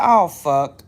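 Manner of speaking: loud, low exclamation of dismay; loud curse. Retold or imitated. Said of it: "I said".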